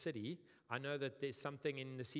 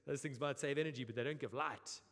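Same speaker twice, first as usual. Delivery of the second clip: South African. Retold or imitated. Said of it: "South Africans, we're like".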